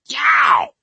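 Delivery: a sudden high, loud whoop of glee; gleeful yelp. Acted. Teenager yelping with glee after a prank, loud and high. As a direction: yelp out like a teen pulling an evil prank. Evil prank, listening for result.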